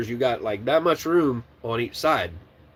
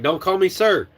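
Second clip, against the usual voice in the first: mock scold; 5 semitones higher, 4 dB louder than usual. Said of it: "I'm like".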